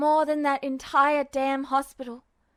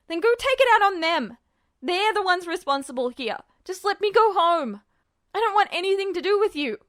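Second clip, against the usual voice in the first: higher, louder, fast desperate shout; frantic yelling; 4 semitones higher, 5 dB louder, faster than usual. A narrator's reading. Ed yelling at the god to go home, loud and fast. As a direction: scream at it to let you go.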